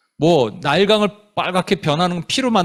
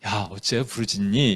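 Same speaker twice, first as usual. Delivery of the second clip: low, gentle, offhand question; soft, casual question; 8 semitones lower, 6 dB quieter than usual. Shown as a demonstration. God asking Moses in the wrong nuance, soft low question. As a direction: ask it softly, without any reproach. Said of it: "이건 아예 느낌은 아니죠".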